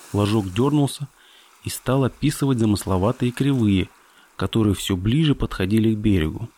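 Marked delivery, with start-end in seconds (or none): none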